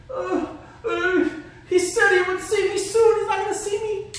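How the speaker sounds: high, frantic and jittery; panicked high voice. Acted. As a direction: play the terrified kid, breathless. Voicing the panicked victim.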